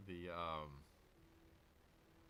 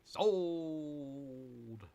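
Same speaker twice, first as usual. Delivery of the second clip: lighter auctioneer. Pretend. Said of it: "like an auctioneer".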